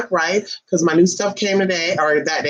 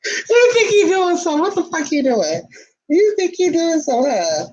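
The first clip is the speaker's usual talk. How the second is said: high, playful pet-talk voice; talking to the dog; 10 semitones higher than usual. Real, not acted. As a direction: tease the dog in a high sing-song. Cooing at the dog.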